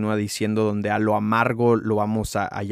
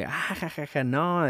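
higher, softer nervous giggle; awkward laugh; 6 semitones higher, 6 dB quieter than usual. Retold or imitated. Girl scolded by the narrator, soft sheepish higher giggle.

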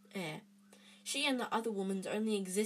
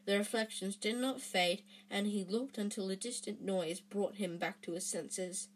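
usual speaking voice

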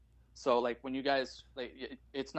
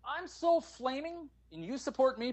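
imagined flamboyant reply; 9 semitones higher, slower than usual. Retold or imitated. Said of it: "I felt like going".

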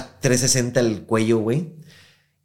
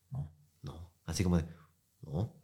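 muted no; 5 semitones lower, 14 dB quieter than usual. Retold or imitated. Old man stopping the talk.

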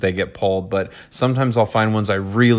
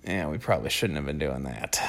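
low, much softer voice; sheepish hindsight; 7 semitones lower, 12 dB quieter than usual. Retold or imitated. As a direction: imitate a quiet, regretful admission. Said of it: "we will look back on".

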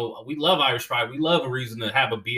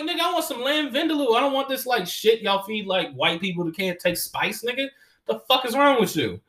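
much higher, incredulous complaint; mock exasperation; 9 semitones higher than usual. Comic complains to the owner in a much higher, mock-exasperated voice. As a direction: vent about the bland food.

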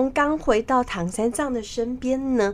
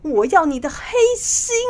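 high, loud accusing cry; scoff, then demand it loudly; 7 semitones higher, 6 dB louder than usual. Acted. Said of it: "指着唐三藏大声地说".

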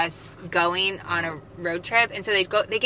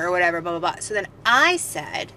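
ordinary talk

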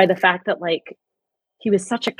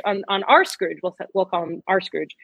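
normal talking voice

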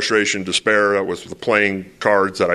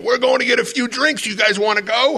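much higher, friendly con-man voice; con artists' invite. Retold or imitated. The con men in Rome invite him for drinks, higher and friendly. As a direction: lure them in, sweetly up high. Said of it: "And they said".

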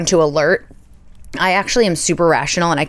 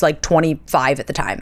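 usual speaking voice

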